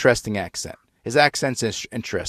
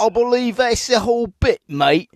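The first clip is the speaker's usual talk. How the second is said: lightly pitched-up Aussie "mate"; a hint of the Australian guy signing off; mock Australian accent; 10 semitones higher than usual. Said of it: "Australian guy?".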